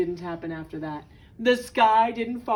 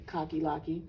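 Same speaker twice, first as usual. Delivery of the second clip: ordinary delivery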